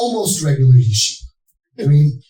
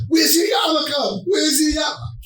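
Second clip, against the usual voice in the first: alarmed shout; 11 semitones higher than usual. Retold or imitated. Parents yelling at their son about his missing yarmulke, shrill panic.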